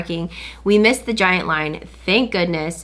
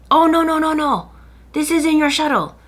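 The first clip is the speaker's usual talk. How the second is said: higher put-on voice of the shuttle driver; imitating driver; 8 semitones higher, 3 dB louder than usual. Imitating the shuttle driver turning them away. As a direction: lightly mimic the smiling driver.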